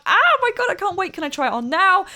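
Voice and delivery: high, loud gushing rush of questions; excited. Acted. Child thrilled by dad's gift, squealing high and loud.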